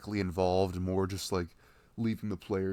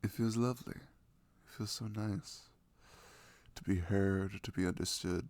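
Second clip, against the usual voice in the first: ordinary talk, nothing marked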